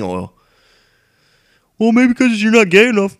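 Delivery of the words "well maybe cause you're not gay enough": much higher, louder camp mocking voice; teasing heckler voice. Imaginary heckler mocking the vlogger, high and loud put-on voice. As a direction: jab at him in a shrieky put-on tone.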